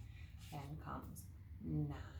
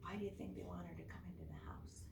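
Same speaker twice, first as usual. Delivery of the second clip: suspenseful whisper; 9 dB quieter, faster than usual. Real, not acted. Teacher builds suspense for students.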